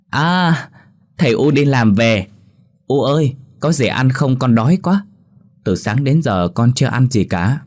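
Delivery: put-on high, eager child's voice calling to his parents; a small boy whining that he's starving; hungry little boy. Young son runs out hungry.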